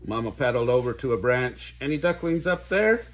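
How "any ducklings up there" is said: calling, a bit higher and louder. A narrator's reading. Mama Duck calling out to her hidden ducklings. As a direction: call out across the pond. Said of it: "she called".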